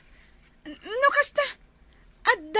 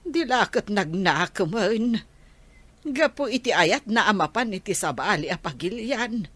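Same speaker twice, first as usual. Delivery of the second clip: lamenting elder; 10 semitones lower than usual. Acted. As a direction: sink low and drag out the lament. Elder lamenting the grandchild's choice.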